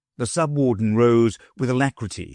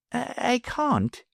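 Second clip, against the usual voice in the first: put-on high, soft faltering old man; stammering professor; 5 dB quieter than usual. A narrator's reading. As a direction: stumble over it meekly. Professor voiced by reader.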